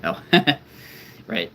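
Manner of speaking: usual speaking voice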